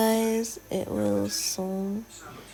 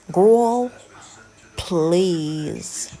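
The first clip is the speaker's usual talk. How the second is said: sassy; 5 dB louder than usual. Acted. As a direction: put them in their place with sass. Scolding viewers for not subscribing.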